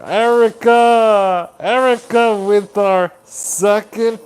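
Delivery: drawn-out, loud, high shout of the name; excited call. Real, not acted. Host cheering a viewer who sent a gift, excited call. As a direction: cheer her name out big and slow.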